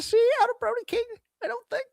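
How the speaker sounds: very high octave. Real, not acted. Host catching his own voice going high. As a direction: voice jumps high and fast. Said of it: "he says with a very high octave in his voice".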